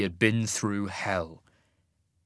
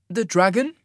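questioning; 9 semitones higher, 5 dB louder than usual. A narrator's reading. Isaac asks about dragon.

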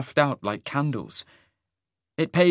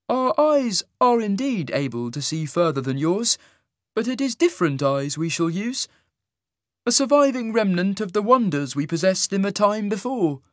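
sing-song voice; 10 semitones higher than usual. A narrator's reading. Isaac's voice.

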